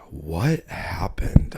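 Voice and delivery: very slow, drawn-out whisper; dazed whisper. Retold or imitated. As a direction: whisper it slowly, as if dazed and drunk. Retelling drunken stumble home, dazed.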